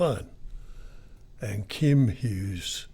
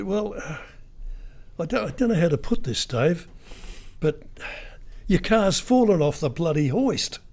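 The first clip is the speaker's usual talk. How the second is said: fake mechanic; 6 semitones higher than usual. Radio host telling the owner his car fell off the hoist, higher mock-hesitant voice. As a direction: fake a reluctant mechanic breaking bad news. Prank caller breaking bad news.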